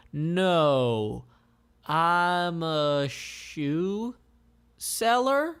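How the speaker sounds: slow, halting cover story rising at the end; stall, then blurt the lie; Jackie's fib. Acted. Jackie hiding her status, halting fib. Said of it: "Jackie replies".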